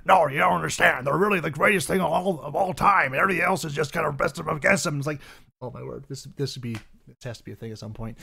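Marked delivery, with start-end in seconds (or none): 0.1-5.0 loud zealous ranting preacher; Alex Jones voice. Retold or imitated. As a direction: rant like a fanatic true believer. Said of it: "And he's like".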